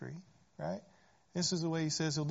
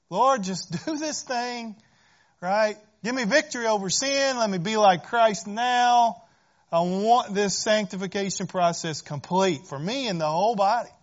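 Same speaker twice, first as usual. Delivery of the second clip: pleading prayer; 5 semitones higher, 10 dB louder than usual. Pretend. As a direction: beg the Lord to hurry up. Preacher voices his own impatient prayer.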